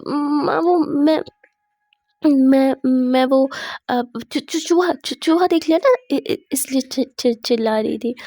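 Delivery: acted hesitant, halting cover-up; stammered excuse. Daughter-in-law inventing an excuse for mother, nervous stammer.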